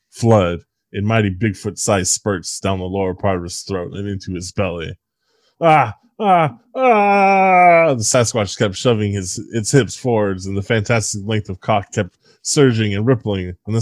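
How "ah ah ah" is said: high, loud rising monster moans; building beastly groans of release; climax cries. Acted. Sasquatch climaxing, rising loud groaning cries.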